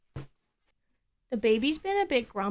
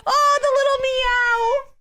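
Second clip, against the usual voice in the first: put-on baby talk; 13 semitones higher, 4 dB louder than usual. Cooing at the kitten's meow.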